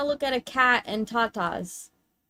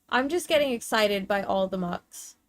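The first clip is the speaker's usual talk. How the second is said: normal talking voice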